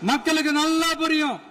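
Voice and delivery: high-pitched, sustained rallying oratory; fiery political speech, phrase by phrase; impassioned rally speech. Real, not acted. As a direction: pitch it high and declaim each phrase to the crowd.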